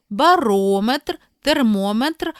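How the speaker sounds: барометр as ba-RO-metr, термометр as ter-MO-metr; second-syllable stress. Shown as a demonstration. Teacher contrasting measuring words, second-syllable stress in барометр, термометр. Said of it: "мають наголос на другому складі".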